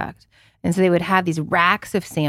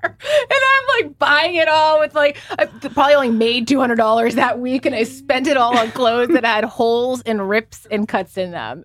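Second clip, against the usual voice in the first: much higher, giggling through the story; laughing; 5 semitones higher than usual. Real, not acted. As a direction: laugh at your younger self. Laughing at own past self.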